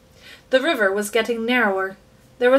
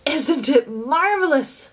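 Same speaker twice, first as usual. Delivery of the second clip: put-on slow, higher, delighted old man; grinning old grandfather; 4 semitones higher, slower than usual. A narrator's reading. Grandfather marvelling to his grandson, grinning. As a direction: grin through it at the boy. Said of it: "grinning at Charlie".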